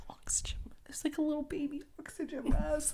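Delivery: hushed awed whisper; tender whispered gush. Real, not acted. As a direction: drop to a whisper, overcome by cuteness. Gushing over the chihuahua video.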